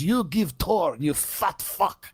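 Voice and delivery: mock Russian woman, slow and blunt; imitating a rude Russian tourist, Russian accent; slow broken-English Russian accent. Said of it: "she goes".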